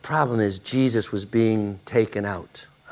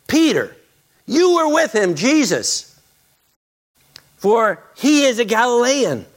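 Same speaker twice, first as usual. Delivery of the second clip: accusing bystander; 11 semitones higher, 4 dB louder than usual. Acted. Accuser voiced in the story.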